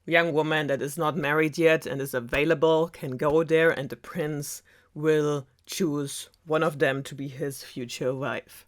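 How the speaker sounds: ordinary delivery, nothing marked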